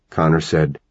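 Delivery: plain reading voice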